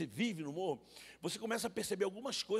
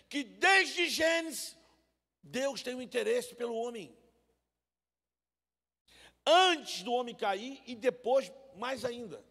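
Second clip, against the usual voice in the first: slow, loud preacher's cadence; full-force preaching; 4 semitones higher, 6 dB louder, slower than usual. Acted. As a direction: drive each phrase home from the pulpit.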